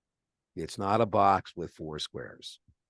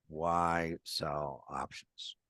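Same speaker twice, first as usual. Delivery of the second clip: slow quieter echo of the question; slow emphatic repeat; 2 semitones lower, 4 dB quieter, much slower than usual. Shown as a demonstration. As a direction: lean on why, very slowly.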